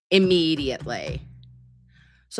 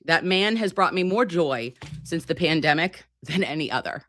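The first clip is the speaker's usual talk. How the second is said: usual speaking voice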